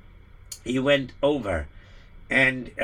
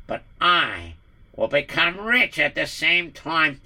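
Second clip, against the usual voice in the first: scheming villain. Acted. Villain Michael mocks the messenger, gloating. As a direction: savor his victory, sneering.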